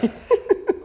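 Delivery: quick high laugh. Real, not acted. Co-host giggling before a question, high laugh.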